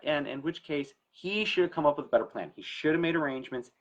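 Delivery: normal talking voice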